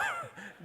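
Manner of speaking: a high burst of laughter mid-sentence; laughing. Real, not acted. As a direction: break into a real laugh. Laughing at his own story.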